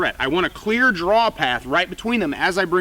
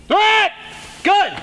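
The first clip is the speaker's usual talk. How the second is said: high, loud callout; shouting; 4 dB louder than usual. Acted. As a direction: call out the threat. Drill, calling out threat.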